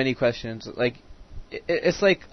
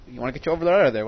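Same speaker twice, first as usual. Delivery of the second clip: normal talking voice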